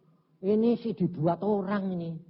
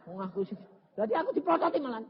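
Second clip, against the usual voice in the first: usual speaking voice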